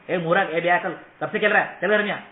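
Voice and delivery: loud, scolding yell at a little brother; rude shouting. Retold or imitated. Elder sibling calling little brother in from the doorway, loud and scolding. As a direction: bark him home like an insulting elder sibling. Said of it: "आवाज कैसे दी".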